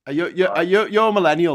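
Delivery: normal talking voice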